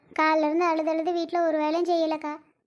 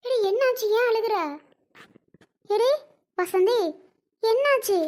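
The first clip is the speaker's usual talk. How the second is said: higher-pitched, worried questioning; alarmed concern; 4 semitones higher than usual. Acted. Friend finds a woman crying and asks why, alarmed concern. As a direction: call out in concern at finding someone crying.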